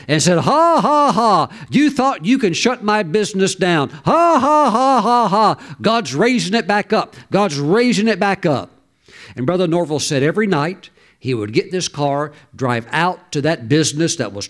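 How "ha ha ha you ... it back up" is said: mocking laughter. Businessman laughs at the devil over his closed business, high mocking taunt. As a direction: imitate a defiant laugh in the enemy's face. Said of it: "laughed and laughed at the devil".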